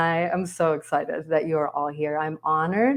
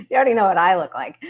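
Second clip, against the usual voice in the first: fast, laughing through the words; 4 semitones higher, 5 dB louder, faster than usual. Real, not acted. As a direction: laugh while saying it. Laughing at own big screen.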